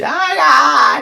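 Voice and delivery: high, loud cry of pain; mock pained cry. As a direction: cry out as if hurt. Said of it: "and she's".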